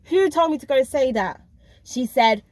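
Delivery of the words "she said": usual speaking voice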